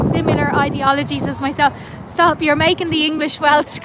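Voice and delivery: ordinary delivery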